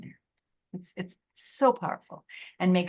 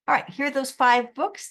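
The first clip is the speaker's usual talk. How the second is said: ordinary talk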